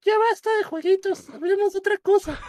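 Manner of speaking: very high, squeaky cockroach voice. Pretend. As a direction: squeak it out like a tiny bug. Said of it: "Con voz de, con voz de cucaracha". (voz de cucaracha aguda y chillona)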